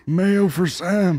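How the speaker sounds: very slow drawn-out mock Biden read; slow mock Biden line. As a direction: drag the line out like a tired old man. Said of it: "just say the fucking line".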